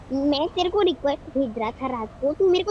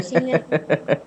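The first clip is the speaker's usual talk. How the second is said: cartoon voice; 11 semitones lower than usual. Real, not acted. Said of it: "ये लोग robot बन के हैं".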